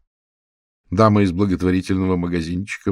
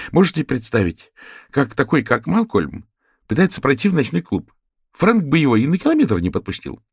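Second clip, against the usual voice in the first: excited; 7 semitones higher, 4 dB louder than usual. Acted. Sue arguing Frank's guilt.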